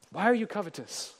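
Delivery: usual speaking voice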